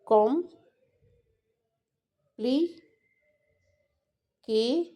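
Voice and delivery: Com- pli- ke-, each beat split off with long pauses; syllable by syllable. Shown as a demonstration. Teacher to students, splitting complicated into syllables. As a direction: break the word into separate beats.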